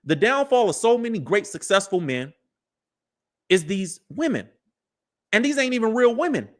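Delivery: ordinary talk, nothing marked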